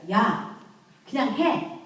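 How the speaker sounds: snapped loud order, pitch jumping up; harsh command. Shown as a demonstration. Parent yelling at a child to just do it. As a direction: bark it at the child like a fed-up parent. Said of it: "이렇게 하지 마세요".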